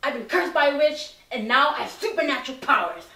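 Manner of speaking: ordinary talk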